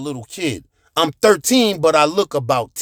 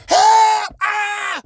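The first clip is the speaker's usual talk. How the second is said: losing it, screaming. Retold or imitated. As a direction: lose it and scream for help. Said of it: "I'm losing it".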